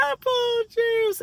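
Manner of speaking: a sleepy, drugged reply drawn out high; dazed groggy answer. Retold or imitated. Waking from anesthesia, answering the nurse: high, slow, dazed. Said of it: "And I was like".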